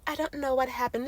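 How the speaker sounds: hushed fake cluelessness; mock innocent denial. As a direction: play dumb quietly. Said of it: "it's just like".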